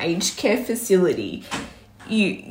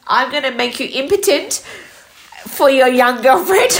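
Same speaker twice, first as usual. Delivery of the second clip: mock threat, heated; 5 semitones higher, 5 dB louder than usual.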